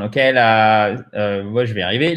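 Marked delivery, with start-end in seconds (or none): none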